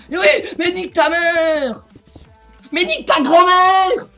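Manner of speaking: slow, loud, high screamed insult; raging gamer. Real, not acted. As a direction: drag out the insult in a furious yell. Insulting the opponent.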